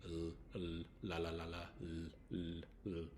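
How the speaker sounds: "L" held as a sound, broken by a run of quick "la, la"; tongue to the roof of the mouth for each "L"; isolated L sound. Shown as a demonstration. Teacher showing students the one L sound, repeated in isolation. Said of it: "So L has one sound".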